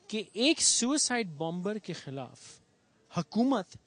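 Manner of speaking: normal talking voice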